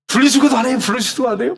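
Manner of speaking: mock the deacon nagging the pastor; scolding deacon. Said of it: "막 그러면서 저한테 제가 혼나".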